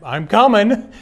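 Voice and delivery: high, loud burst of eagerness; sudden eager lift in the voice; excited. Real, not acted. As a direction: blurt it out, thrilled. Eager reply to caller.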